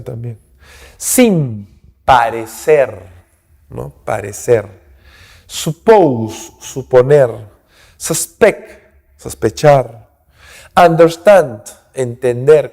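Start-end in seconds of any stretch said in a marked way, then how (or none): none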